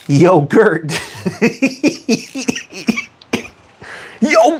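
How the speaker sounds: high, helpless giggling; cracking up. Real, not acted. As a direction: laugh through the silly punchline. Cracks up at own yogurt joke.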